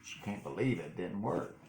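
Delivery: usual speaking voice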